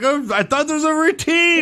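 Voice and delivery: high-pitched mock protest; protesting excuse. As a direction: plead innocence as a hapless performer. Acts out fired Glenn's excuse.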